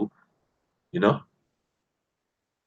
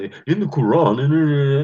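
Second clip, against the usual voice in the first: higher, louder mocking imitation of a preachy outsider; 6 semitones higher, 5 dB louder, slower than usual. Speaker mimicking the outsider preaching at locals, mock preachy. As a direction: mimic his preaching, pitched up and pushed louder. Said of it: "come with some kumbaya in".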